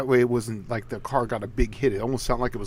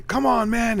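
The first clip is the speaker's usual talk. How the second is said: higher-pitched pleading goad; 10 semitones higher than usual. Acted. Rival pushing a street racer to race on, goading. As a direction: egg him on, pitched well up.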